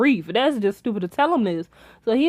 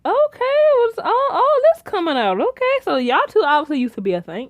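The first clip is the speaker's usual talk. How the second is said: surprised; 10 semitones higher than usual. Retold or imitated. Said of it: "So I'm like".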